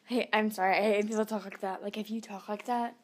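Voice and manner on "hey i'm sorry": ordinary talk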